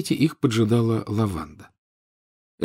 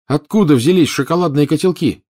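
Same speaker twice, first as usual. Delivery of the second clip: urgent; 7 semitones higher, 5 dB louder than usual. Acted. Harry urgent realization.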